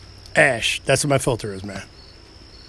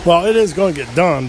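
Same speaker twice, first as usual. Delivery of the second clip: usual speaking voice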